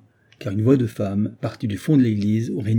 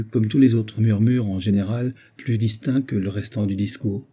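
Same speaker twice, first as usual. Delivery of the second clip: ordinary delivery, nothing marked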